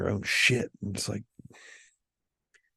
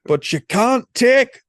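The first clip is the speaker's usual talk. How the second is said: slow, building mock-Scottish battle shout; hammed-up Scottish accent; 13 semitones higher, 4 dB louder, much slower than usual. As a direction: climb to a drawn-out Scottish yell on the last word. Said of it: "attempt at a Scottish accent".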